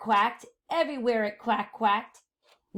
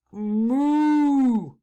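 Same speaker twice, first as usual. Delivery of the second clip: loud drawn-out moo; cow moo; 2 semitones higher, 6 dB louder than usual. Acted. Cow answering the witch, loud long moo.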